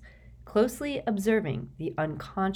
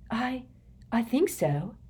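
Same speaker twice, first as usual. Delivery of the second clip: shaky; 7 semitones higher than usual. A narrator's reading. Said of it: "she said shakily".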